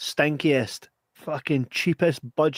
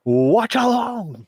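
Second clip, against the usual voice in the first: announcer flourish; 10 semitones higher, 4 dB louder, much slower than usual. Acted. Announcing the show title.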